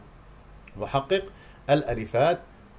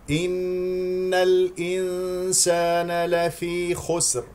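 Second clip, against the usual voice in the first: very slow, higher tajweed chant; Quran recitation; 6 semitones higher, much slower than usual. Shown as a demonstration. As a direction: recite with long held nasal sounds. (تلاوة مرتلة بمدود وغنة)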